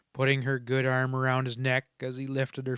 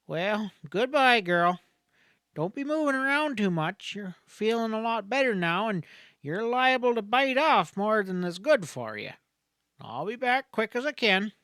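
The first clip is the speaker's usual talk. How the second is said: higher-pitched voice of a folksy country doctor; kindly doctor's goodbye; 8 semitones higher than usual. A narrator's reading. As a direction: send her off like a fatherly frontier doctor. Doctor says goodbye to Helen.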